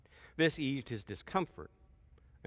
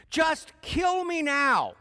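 high, loud, slow anguished wail; begging God to end it, high and drawn out; despairing plea; 14 semitones higher, 8 dB louder, much slower than usual. Acted. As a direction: drag it out like a prophet at the end of his rope. Jonah begs to die.

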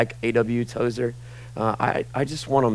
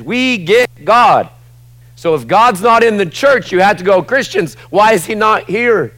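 high, loud, urgent sermon delivery; fervent preacher at full volume; full-force preaching; 9 semitones higher, 9 dB louder than usual. Real, not acted. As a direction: build to an impassioned cry to the church.

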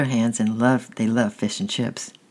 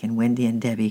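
ordinary delivery, nothing marked

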